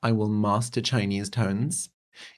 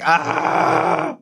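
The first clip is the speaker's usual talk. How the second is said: frustrated cry; 7 semitones higher, 5 dB louder than usual. Retold or imitated. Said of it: "And I'm like".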